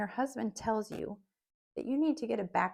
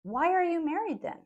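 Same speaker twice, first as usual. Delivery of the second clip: incredulous; 7 semitones higher, 5 dB louder than usual. Retold or imitated. Incredulous rhetorical question.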